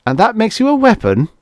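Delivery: high-pitched incredulous question. A narrator's reading. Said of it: "asked Bates incredulously".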